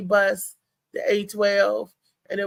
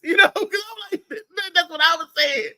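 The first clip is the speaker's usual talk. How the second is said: laughing; 8 semitones higher than usual. Real, not acted. Laughing at the story.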